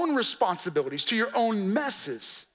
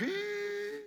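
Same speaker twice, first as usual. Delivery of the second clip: dismissive shrug; 11 semitones higher, 11 dB quieter than usual. Retold or imitated. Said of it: "kinda pull back and be like".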